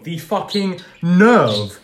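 slow, outraged disbelief. Real, not acted. Podcast host fuming at Hamilton's quote, slow and outraged. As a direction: drag out the outrage.

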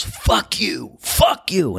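high-pitched mock yell; retold yell without full volume; Clyde yelling. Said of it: "starts coming at us and yells".

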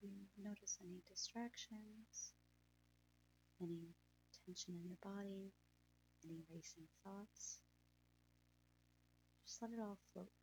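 breathy, hushed calming guide; guided meditation. Acted.

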